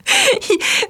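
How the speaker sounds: high delighted giggle; high burst of laughter. Real, not acted. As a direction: laugh along, high and bright. Shared laugh at joke. (éclat de rire aigu et joyeux)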